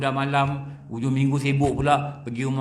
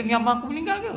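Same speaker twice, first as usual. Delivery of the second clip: imitated panic; 12 semitones higher, much faster than usual. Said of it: "Dia panik".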